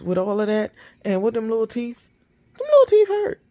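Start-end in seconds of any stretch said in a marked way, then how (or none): 2.6-3.4 squealed high and loud; exclaim. Real, not acted. Owner complaining about small dogs, squealing loud and high. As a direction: shriek the word teeth.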